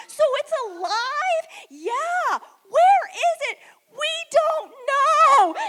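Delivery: high, loud freak-out; panicked. Retold or imitated. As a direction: shout in alarm. Panicked cockroach exchange, girls.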